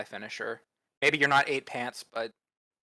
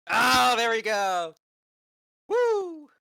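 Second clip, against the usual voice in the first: loud, high delighted whoop; excited cheer; 11 semitones higher, 7 dB louder, much slower than usual. Real, not acted. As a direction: cheer a friend on, slow and loud. Cheering the accent demo.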